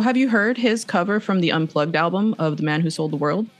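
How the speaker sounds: normal talking voice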